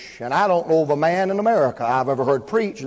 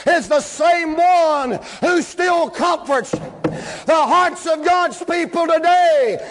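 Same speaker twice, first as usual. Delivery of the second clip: high, drawn-out revival preaching shout; whooping Southern-style sermon climax; full-force preaching; 11 semitones higher, much slower than usual. Acted.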